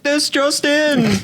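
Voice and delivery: high, slow cartoon anchor cry; news fish voice. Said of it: "And he's like".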